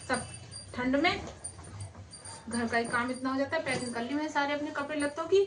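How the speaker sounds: low, soft grumble to herself; grumbling. Acted. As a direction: mutter your complaints under your breath. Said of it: "कैसे बड़बड़ा रही हो".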